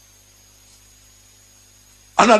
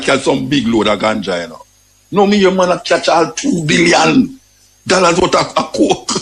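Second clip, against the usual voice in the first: ordinary talk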